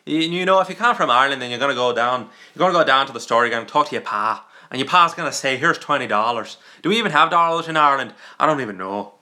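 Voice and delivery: lively put-on Irish accent; improvised Irish brogue. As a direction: chatter away in a mock Irish accent. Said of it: "see, that's Irish".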